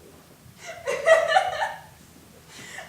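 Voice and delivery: high, loud broken laughter; hysterical sobbing laugh. Acted. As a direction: laugh on the edge of tears.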